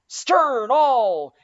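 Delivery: high, loud order to back water; command shout. A narrator's reading. Stubb ordering oarsmen to back water, loud shout. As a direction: yell the order over the sea. Mate orders boat backed.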